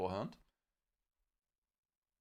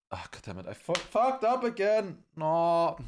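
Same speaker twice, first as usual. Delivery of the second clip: frustrated; 5 semitones higher, 4 dB louder than usual. Real, not acted. Flubbed intro, frustrated outburst.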